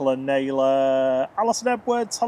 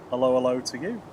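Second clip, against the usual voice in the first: ordinary talk, nothing marked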